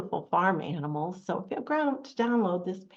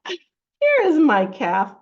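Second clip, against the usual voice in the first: high, loud, slow announcement; cow character; 6 semitones higher, 10 dB louder, slower than usual. Acted. Storyteller voicing a cow finding her calf, loud. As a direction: proudly draw it out as a cow.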